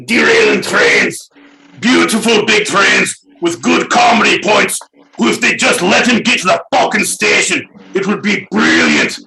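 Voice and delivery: over-the-top angry dad shouting about trains; ranting father. Retold or imitated. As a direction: bellow down the wife in a hammed-up rage. Father voiced ranting at mother.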